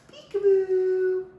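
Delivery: drawn-out high playful peekaboo. Pretend. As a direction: stretch it out high for a baby. Peekaboo game with a child.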